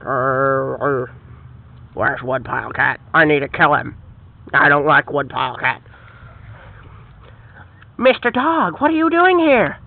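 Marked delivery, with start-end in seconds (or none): 0.0-5.8 very low growly villain voice; menacing dog villain. Acted. Dog villain plotting against the cat, very low menacing voice. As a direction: drop way down like a brute dog.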